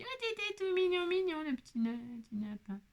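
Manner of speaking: put-on high, soft cooing baby talk. Crafter cooing softly at her finished bunny, in baby talk. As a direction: melt over it like a tiny pet.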